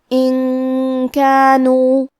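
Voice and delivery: ان کانوا, the noon hidden in a long nasal hum; ان کانوا with ikhfa ghunna, drawn out. Shown as a demonstration. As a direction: recite ان کانوا slowly, noon concealed and nasal. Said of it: "نون کو چھپاتے ہوئے اور غنہ کے ساتھ".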